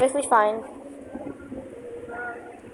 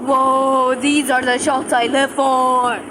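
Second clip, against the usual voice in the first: excited; 3 semitones higher, 5 dB louder, slower than usual. Real, not acted. Excited at lightning shot.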